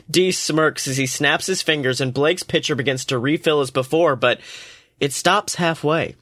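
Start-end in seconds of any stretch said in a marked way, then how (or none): none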